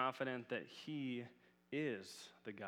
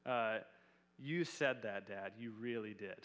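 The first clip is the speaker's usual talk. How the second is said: imitating his little daughter; 6 dB louder than usual. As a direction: mimic a kid holding dad to his word. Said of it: "That's how she would say it".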